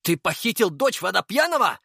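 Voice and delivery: high, astonished question; shocked exclamation. A narrator's reading. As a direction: blurt it out in disbelief. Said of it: "воскликнул Артём".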